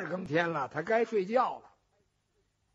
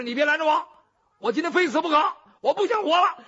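defiant refusal; 8 semitones higher, 7 dB louder, faster than usual. Acted. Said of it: "程咬金说".

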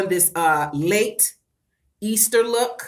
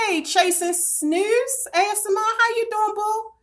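sing-song greeting; 13 semitones higher than usual. Real, not acted. Greeting a live chat viewer.

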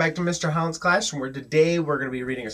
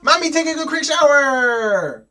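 high, loud, drawn-out call; mock-dramatic announcement; 12 semitones higher, 8 dB louder than usual. A narrator's reading. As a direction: announce the challenge at full pitch.

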